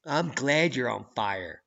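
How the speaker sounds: ordinary delivery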